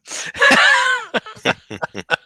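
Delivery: high shrieking burst of laughter; real loud laugh. Real, not acted.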